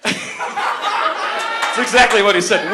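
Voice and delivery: usual speaking voice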